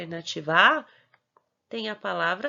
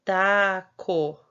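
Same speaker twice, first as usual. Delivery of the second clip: taco split loudly into ta, co; syllable by syllable; 6 dB louder than usual. Shown as a demonstration. Teacher presenting the model word, taco in clear syllables. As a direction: give taco as two separate, firm syllables. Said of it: "nós temos a palavra".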